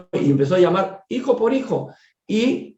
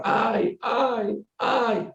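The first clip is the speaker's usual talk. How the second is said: high, soft moaning ay ay ay; mild mock pained wails; imitated cries of pain; 8 semitones higher, 5 dB quieter, much slower than usual. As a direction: echo a suffering patient's faint cries from afar. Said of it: "unos ayes de dolor".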